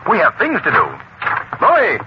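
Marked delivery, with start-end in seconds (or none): 1.6-2.0 high-pitched summoning shout; calling out yell. Acted. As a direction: yell for someone outside the room. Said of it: "you don't have to yell".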